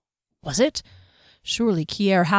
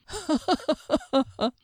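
light snickering laugh, higher and soft; guards laughing; 8 semitones higher, 5 dB quieter than usual. A narrator's reading. Guards snicker over the gossip, a soft high laugh. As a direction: snicker at the joke.

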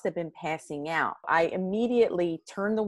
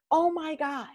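excited; 9 semitones higher than usual. Retold or imitated. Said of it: "and I'm like".

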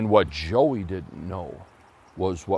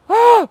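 piercing high scream, much louder; 15 dB louder than usual. Acted. Joey sees a wild boar charge, high piercing scream. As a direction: let out a terrified shriek. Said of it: "And Joey screamed".

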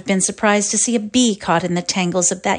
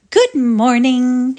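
high, loud and slow, sugary and put-on; sweet affected drawl; 5 semitones higher, 5 dB louder, slower than usual. A narrator's reading. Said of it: "she cried in her sweet, affected drawl".

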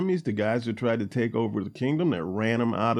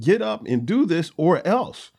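slower, higher mock threat, leaning on each word; 6 semitones higher, 3 dB louder, slower than usual. Joab threatening his grieving king, a mock stern ultimatum. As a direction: play Joab laying down an ultimatum, half-serious. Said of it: "Joab is essentially threatening David".

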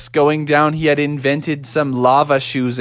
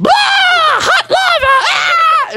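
very high, louder cartoon scream; panicked shriek; 4 dB louder, much slower than usual. Sister Bear as lava bursts in, high panicked shriek. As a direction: shriek in hammed-up alarm.

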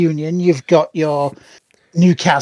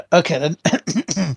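usual speaking voice

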